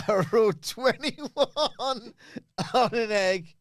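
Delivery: slow, laughing through the words; giggling while telling. Real, not acted. Laughing at own joke.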